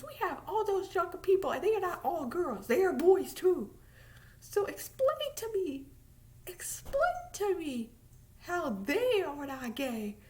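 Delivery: normal talking voice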